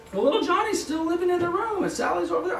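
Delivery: high, whining homeowner voice; whiny seller. Retold or imitated. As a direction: plead like a fussy seller. Seller objecting in acted quote.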